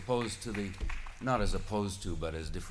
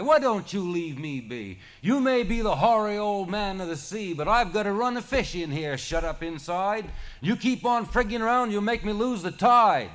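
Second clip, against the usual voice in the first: high, loud exasperated tirade; exasperated shout; 13 semitones higher, 11 dB louder than usual. A narrator's reading. Charlie tells the old man he'll lose the tide, loud exasperated tirade. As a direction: let it all out at the old god.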